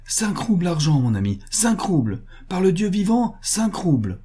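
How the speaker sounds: high-pitched, pleading bargaining; frantic offer. A narrator's reading. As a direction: swear to the price, desperately. Butcher frantically offers money.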